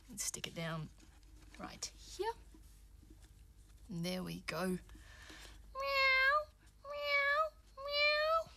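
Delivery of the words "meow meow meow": high, spaced-out meows; cat meowing. Pretend. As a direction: meow up high like a kitty. Voices the toy cat.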